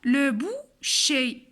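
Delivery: "le boucher" slowly split, ending in é; teacher's measured restatement; corrected model word. Shown as a demonstration. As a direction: sound out "le boucher" in careful pieces. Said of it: "عفوًا".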